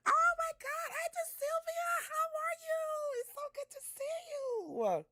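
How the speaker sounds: high, squealing hello to a friend; delighted high-pitched welcome; excited greeting. Real, not acted. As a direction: greet her in a thrilled high voice. Greeting friend Sylvia on stream.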